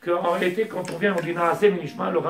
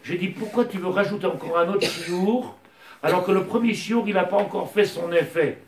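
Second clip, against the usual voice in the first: raised, louder sermon voice driving each question home; fervent preaching; 4 semitones higher, 4 dB louder than usual. Acted. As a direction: preach it to the congregation with fire. Rabbi preaching at full force.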